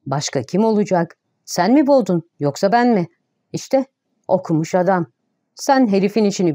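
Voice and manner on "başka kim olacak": ordinary delivery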